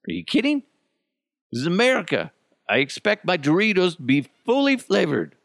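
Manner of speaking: high-pitched, indignant Doritos rant; pissed-off rant. Acted. Ranting about underflavored Doritos, pissed off. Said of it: "I'm, like, pissed off".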